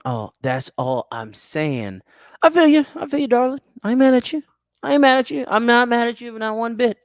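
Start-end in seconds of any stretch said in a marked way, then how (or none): 2.4-7.0 much higher, sugary reassuring coo; mock sweet high voice. Soothing Lola J, mock sweet.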